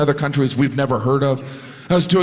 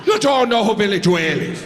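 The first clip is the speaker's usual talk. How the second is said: high, laughing Irish disbelief; incredulous Irish sound guy; 7 semitones higher than usual. Retold or imitated. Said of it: "And he goes".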